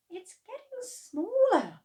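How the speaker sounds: put-on high, anxious boy voice; alarmed at the shrinking hen; worried boy. A narrator's reading. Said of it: "George said".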